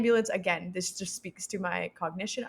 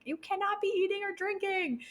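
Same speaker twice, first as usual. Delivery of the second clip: mock yell; 13 semitones higher than usual. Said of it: "yelled at me that".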